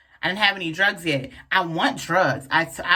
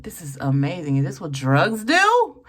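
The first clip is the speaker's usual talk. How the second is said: amazed by drugs; 2 semitones lower, 4 dB louder than usual. Retold or imitated.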